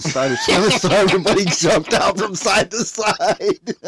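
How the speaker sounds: words broken up by real laughter; laughing. Real, not acted. Telling friend about the swimming turkey, laughing hard.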